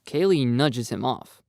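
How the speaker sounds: ordinary delivery, nothing marked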